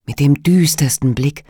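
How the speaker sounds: ordinary delivery, nothing marked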